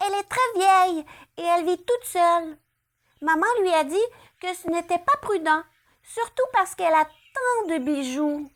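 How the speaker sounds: put-on high childish chatter; chatty little girl. A narrator's reading. Girl chats with rabbit, high childlike. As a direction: tell it innocently.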